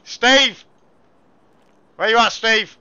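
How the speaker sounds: loud, high shout across the shop; calling out. Pretend. Shop owner summons security, calling out loudly. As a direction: holler for someone out of sight. Dealer calls for his security man.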